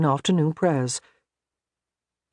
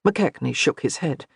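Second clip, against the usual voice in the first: usual speaking voice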